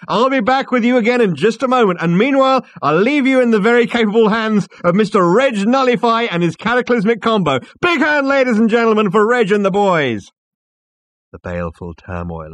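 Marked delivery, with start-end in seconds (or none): 0.1-10.3 high, booming emcee shout; full-volume showman, pitched high; crying out. A narrator's reading. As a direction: whip up applause for the band. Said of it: "cried Max".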